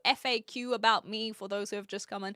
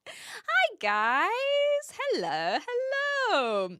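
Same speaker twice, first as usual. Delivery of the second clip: excited high greeting; 10 semitones higher, much slower than usual. Real, not acted. Greeting raiders arriving on stream.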